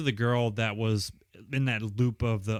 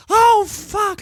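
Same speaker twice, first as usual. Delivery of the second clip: very high, much louder curse; mock panicked yelp; 12 dB louder than usual. Recliner panicking at the second match, high loud yelp. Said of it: "and he goes".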